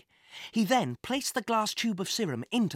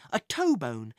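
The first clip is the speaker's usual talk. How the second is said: plain reading voice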